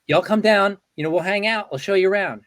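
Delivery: husband mimicking Tina's Southern hospitality; imitating Southern accent. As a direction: lightly play Tina's Southern invite. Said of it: "I love the Southern accent".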